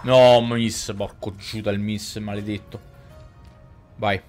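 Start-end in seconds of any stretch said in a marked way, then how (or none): none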